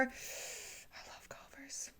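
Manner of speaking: very soft, slow whisper; whispered adoration. Real, not acted. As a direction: confess your love in a slow whisper. Whispered aside about favorite restaurant.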